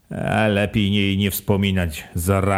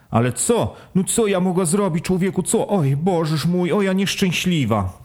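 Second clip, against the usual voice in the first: despairing wife; 8 semitones higher than usual. Acted. Wife despairing at her husband's reproach, higher wail. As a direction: wail it out, higher, wringing your hands.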